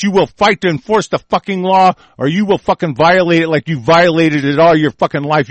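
ordinary delivery

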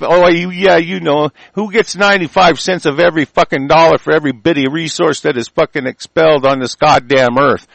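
ordinary delivery

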